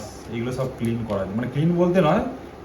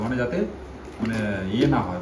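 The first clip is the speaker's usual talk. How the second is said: ordinary talk, nothing marked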